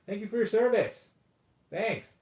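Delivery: high, loud, hollow thank-you; mock gratitude. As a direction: gush it out with fake sincerity. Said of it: "And then we say".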